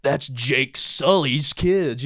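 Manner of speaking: very slow, weighty movie line; mock dramatic line. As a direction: drag it out like a trailer. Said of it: "how many times they say Jake Sully".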